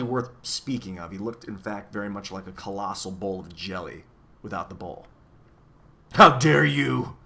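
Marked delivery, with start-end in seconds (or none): 6.1-7.2 slow, loud giant's outrage; furious bellow. A narrator's reading. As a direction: bellow it slowly, building to full volume. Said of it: "he bellowed furiously".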